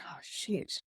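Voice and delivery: whispered dismay. Retold or imitated. Seeing the pregnancy test result.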